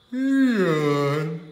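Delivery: loud, high stretched yawning voice; big yawn. A narrator's reading. Narrator acting out Andrew's yawn, big loud yawn. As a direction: yawn the words out.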